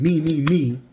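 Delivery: self-centred mock chant; whiny me me me; mocking selfish whine. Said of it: "in me saying".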